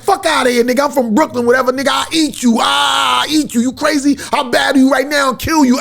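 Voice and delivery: hammed-up battle threat; retold angry boast. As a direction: bark the brag back like he did. Rival's boast retold in argument.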